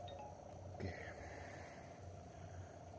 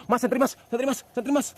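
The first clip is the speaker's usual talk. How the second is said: much higher, screaming for the flashlight; panicked screaming; 13 dB louder than usual. Real, not acted. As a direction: scream in terror.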